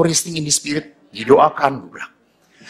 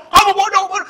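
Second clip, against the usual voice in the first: high, quick mock telling-off; imitated scolding; 12 semitones higher, much faster than usual. Father calling his son stupid, imitated scolding.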